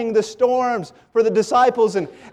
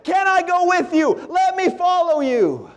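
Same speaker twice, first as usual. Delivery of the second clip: preacher voicing an urgent plea; begging; 10 semitones higher than usual. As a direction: beg to be allowed along, pitched well up. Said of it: "begged him and said".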